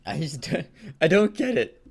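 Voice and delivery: normal talking voice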